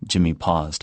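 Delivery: plain reading voice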